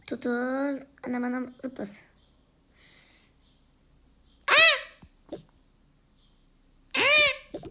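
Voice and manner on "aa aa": very high, very loud scream then cry; falling scream. Acted. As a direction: scream as you fall. Falling while jumping. (düşerken çığlık)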